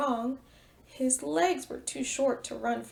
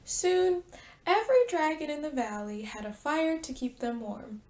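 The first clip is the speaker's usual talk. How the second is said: usual speaking voice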